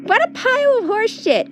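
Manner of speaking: high scornful outburst; shrill mocking exclamation; exclaiming. A narrator's reading. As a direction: spit out the disbelief. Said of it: "exclaims Sif".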